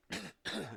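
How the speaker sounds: a harsh real cough from a cold. Real, not acted. Radio host sick with a cold, coughing on air. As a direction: cough it out, rough and sudden. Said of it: "this cough is just ungodly".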